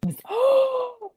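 high, sharp delighted gasp; excited gasp. Real, not acted. Friend hears the teacher name, high thrilled gasp.

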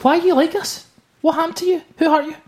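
very high, wounded pleading; mock hurt. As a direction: question them in hammed-up dismay. Mock wounded grilling of co-host.

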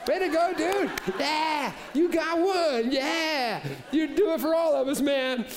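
high, loud hammed-up cheers; cheering crowd. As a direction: hype him up like a stadium crowd. Crowd clapping for the chainsaw.

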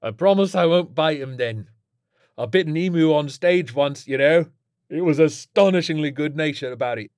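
cheeky druggie mate. Acted. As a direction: reminisce breezily about a mad exploit.

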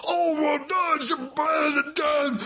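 high, slow hammed-up angry Scots mumble; mock Scottish accent. Scot back from two years over the hill, hammed-up accent. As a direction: ham up a thick Scottish accent.

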